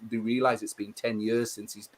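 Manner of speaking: ordinary talk, nothing marked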